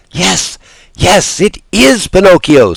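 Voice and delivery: high excited scream. Acted. Pulcinella answering Harlequin on stage, excited scream. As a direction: shriek it with delight. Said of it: "screamed Pulcinella".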